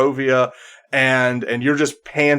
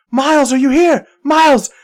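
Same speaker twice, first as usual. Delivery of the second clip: loud, high searching shout; excited calling out; 8 dB louder than usual. Acted. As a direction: call out urgently for a missing friend. Game master voices character calling out.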